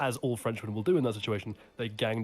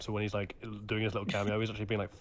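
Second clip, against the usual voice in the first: ordinary talk, nothing marked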